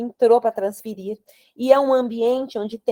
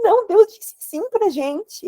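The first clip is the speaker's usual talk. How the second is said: high, slow, emotional insistence; retold joyful amazement; 10 semitones higher, slower than usual. Telling husband God said yes, joyful amazement. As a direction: retell it high and drawn out, overcome.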